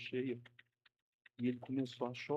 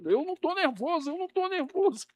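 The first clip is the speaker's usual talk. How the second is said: mock nervous denial; 7 dB louder than usual. Preacher mimics man insisting calm.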